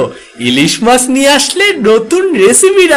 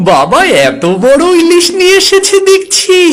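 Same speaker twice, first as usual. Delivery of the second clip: put-on child's voice; 10 semitones higher than usual. Child voices excitement at big fish.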